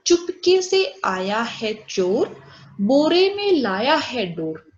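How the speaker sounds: put-on slow sing-song rhyme for kids; slow, lilting classroom rhyme; rhyme recitation. Shown as a demonstration. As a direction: recite it like a nursery rhyme, slowly.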